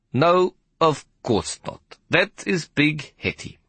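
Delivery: high, slow conductor realizing the worst; dawning dread. Acted. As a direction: let the realization sink in slowly.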